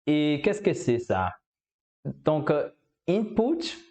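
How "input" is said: "input" said the English way, much higher with a rising end; "input" in English, pitched up and rising. Shown as a demonstration. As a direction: give "input" its English sound, lifted high.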